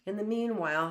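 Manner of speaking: usual speaking voice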